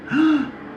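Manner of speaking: high surprised gasp; quick high intake of breath; sharp gasp. Real, not acted. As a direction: gasp as if struck by something. Sudden gasp.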